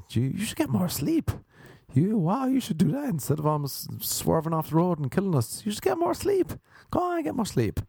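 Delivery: squeaky-high, earnest telling-off; mock-concerned high-pitched nagging; naive scolding. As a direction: scold cluelessly, voice climbing very high. Naive scolding of swerving driver.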